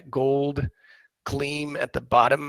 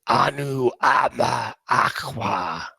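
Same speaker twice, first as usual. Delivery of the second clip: booming voice; slower than usual. A narrator's reading. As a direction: boom it out slowly like a god. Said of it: "you hear a huge booming voice that".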